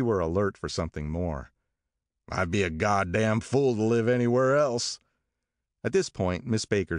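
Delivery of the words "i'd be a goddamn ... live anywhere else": louder, blustering rich husband; a hint of swaggering bully; brash Tom. A narrator's reading. Tom voiced in story.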